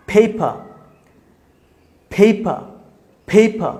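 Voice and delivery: "ফেইপা" with puffed p, long ei, no r; aspirated P, silent R. Shown as a demonstration. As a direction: drill "ফেইফা" slowly in two syllables, British ending. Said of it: "আর এর উচ্চারণ হবে না". ("ফেইফা" ফ দিয়ে, র ছাড়া)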